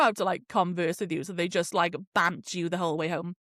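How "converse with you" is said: usual speaking voice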